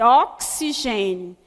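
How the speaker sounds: "Oxigênio" slow, x said as ks, oksigênio. Shown as a demonstration. Teacher models "Oxigênio" slowly, x as ks. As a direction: draw out the ks in "Oxigênio". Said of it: "tem um C e um S".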